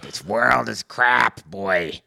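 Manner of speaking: put-on slow, drawn-out old man grumbling; grumpy old man. Podcaster mocking himself as a cranky old man. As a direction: growl it like a cranky old man on his lawn. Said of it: "sound like an old man out on the lawn".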